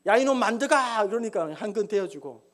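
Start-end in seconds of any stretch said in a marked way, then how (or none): 0.1-1.1 louder, higher barked summons; acted lordly rudeness; dismissive, high-handed. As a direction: bark at an underling with contempt. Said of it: "무시하는 투로".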